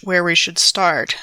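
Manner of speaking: normal talking voice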